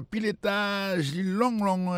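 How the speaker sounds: high, stretched-out words. Real, not acted. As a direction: stretch the words high to show great height. Describing a very tall monument.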